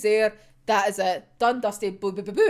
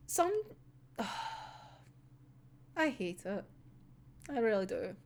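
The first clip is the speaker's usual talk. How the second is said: much softer, defeated; quiet resignation; 2 semitones lower, 14 dB quieter than usual. Real, not acted. Fan giving up on the panel, soft and defeated. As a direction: sigh it out, beaten.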